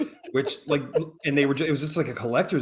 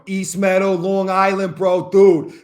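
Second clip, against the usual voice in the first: put-on Long Island accent, louder on the last word; Long Island bro accent; 3 semitones higher, 5 dB louder, slower than usual. Recalling a college roommate, in his Long Island accent. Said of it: "Long Island talked like that".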